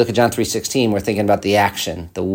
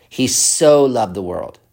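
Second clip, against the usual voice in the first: "so" drawn out and leaned on, slow; stress on so. Shown as a demonstration. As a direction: lean hard on "so". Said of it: "The one is the particle so".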